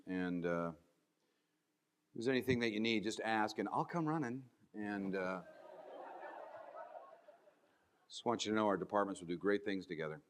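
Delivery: low, soft and sour, nice words said gloomily; grumpy delivery. Shown as a demonstration. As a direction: kind words with a scowl in the voice. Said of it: "You lost me at hello".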